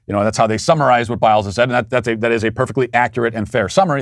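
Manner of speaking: ordinary talk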